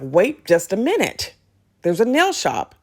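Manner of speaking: slow, swooping double take; intrigued discovery. Retold or imitated. Mom spotting a nail shop on a walk, intrigued. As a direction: draw it out, pitch leaping on the find.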